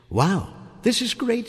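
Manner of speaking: put-on high, thrilled young girl voice; excited girl. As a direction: gush with delight like a girl. Susan voiced, high delight.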